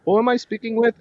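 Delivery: high put-on phone query; imitated telemarketer. Telemarketer asking who picked up the phone, high put-on voice. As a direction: mimic the caller asking politely. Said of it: "And they're like".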